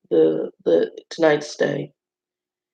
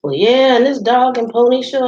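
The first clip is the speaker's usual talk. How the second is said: Southern drawl; 7 semitones higher, 4 dB louder than usual. Retold or imitated. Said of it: "heavy Southern drawl".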